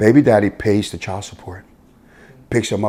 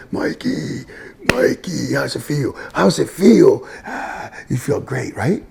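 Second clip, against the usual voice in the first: hoarse, higher-pitched cheer building louder; excited celebration; 6 semitones higher, 3 dB louder, slower than usual. Retold or imitated. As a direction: re-enact a coach cheering his player's touchdown. Coach celebrating player's first touchdown.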